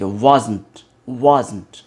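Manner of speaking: wasn't falling, second vowel swallowed; smooth pronunciation. Shown as a demonstration. English teacher asking for smoothness, smooth falling wasn't. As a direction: glide through wasn't as "waaz-nt". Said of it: "बिल्कुल smooth होना चाहिए".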